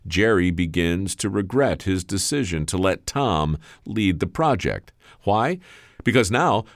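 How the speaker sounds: measured, dramatic storyteller's read with weighty pauses; story narration. A narrator's reading. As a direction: narrate it like a tale building to its reveal.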